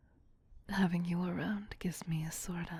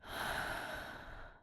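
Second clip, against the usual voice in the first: a soft, breathy sigh of regret; weary whispered sigh; 6 dB quieter than usual. Acted. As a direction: sigh as if the war weighs on her. Vampire queen weary of war.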